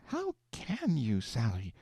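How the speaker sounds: a scandalised wife, recoiling; shuddered protest. A narrator's reading. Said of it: "Aleck shuddered and said".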